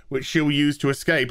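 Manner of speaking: ordinary talk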